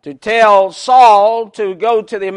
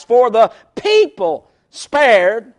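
people set apart and pitched up, slowly; stress on people; slower than usual. Real, not acted. Preacher quoting Saul's excuse to Samuel, stress on people. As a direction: lean hard on "people", drawing the phrase out. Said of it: "I keep emphasizing that word people".

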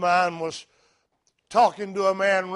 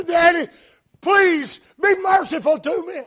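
put-on high, loud, tearful little-girl plea; pleading daughter; 11 semitones higher, 8 dB louder than usual. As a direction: beg Daddy for mercy as his young daughter. Daughter begging her father.

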